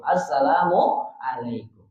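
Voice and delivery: Assalamualaikum said slowly in one unbroken breath; slow connected demo. Shown as a demonstration. Teacher shows joined greeting, slow and unbroken. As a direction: join Assalamualaikum smoothly, no gap. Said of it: "jangan diam atau jeda".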